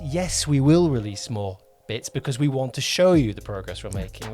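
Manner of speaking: normal talking voice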